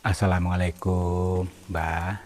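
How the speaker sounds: slow, soft, respectful greeting; gentler, attuned intonation. Real, not acted. Explorer greeting the elder to connect, soft and respectful. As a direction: ease the greeting out gently and slowly. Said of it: "Mari kita selaraskan, baik dari intonasi bicara".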